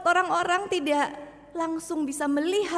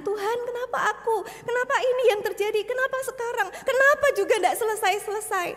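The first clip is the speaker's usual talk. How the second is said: high, pressing cries to God; pleading questions; 8 semitones higher than usual. Acted. Weary believer questions God. (pertanyaan mendesak kepada Tuhan dengan nada tinggi)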